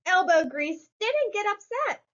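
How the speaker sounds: plain reading voice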